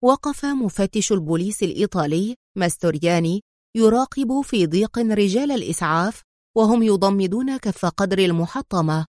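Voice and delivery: ordinary delivery, nothing marked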